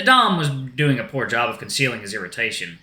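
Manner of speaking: usual speaking voice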